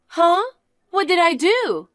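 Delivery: feigned surprise. Acted. Niece feigns innocence.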